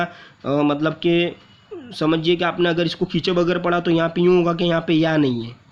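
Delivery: ordinary delivery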